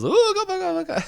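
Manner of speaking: very high, silly spooky hooting; mock scary booga-booga. Eustace pulls out mask to scare Courage, mock scary booga-booga. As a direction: babble a playful boo to startle someone.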